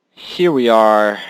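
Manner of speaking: slow, loud, dramatic "Here we are"; mock epic reveal; drawn-out announcement. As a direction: announce it grandly. Dramatic arrival.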